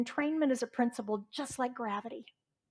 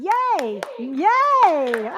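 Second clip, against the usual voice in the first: loud, high drawn-out cheer; 6 semitones higher, 13 dB louder than usual. Real, not acted. Leader applauding a member's day count, cheering. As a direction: celebrate someone's progress at full voice.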